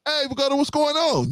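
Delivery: high breezy hello, lightly acted; cheery greeting. Friend rolling into the coffee shop, high cheery hello. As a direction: call out hey like bumping into a pal.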